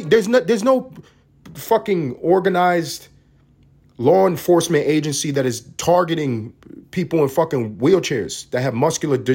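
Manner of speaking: ordinary talk, nothing marked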